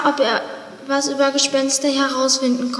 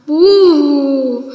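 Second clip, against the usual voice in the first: loud spooky ghost call; ghost boo; 7 dB louder than usual. Acted. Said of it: "Sie hörten sich wie Gespenster an".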